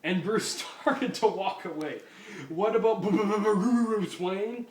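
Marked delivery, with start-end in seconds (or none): 2.5-4.7 slow, high, loud stutter breaking into laughter. Acted. Cop repeating the name, slow stutter cracking up. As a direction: stammer the name while laughing.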